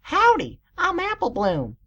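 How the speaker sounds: high-pitched Southern filly; strong Southern accent. A narrator's reading. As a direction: chirp it with a Southern twang. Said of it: "with a strong southern accent".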